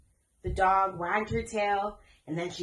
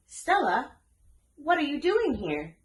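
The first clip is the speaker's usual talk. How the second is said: higher, startled asking; surprised question; 8 semitones higher than usual. A narrator's reading. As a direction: ask the dog in surprise. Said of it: "asked Tiana".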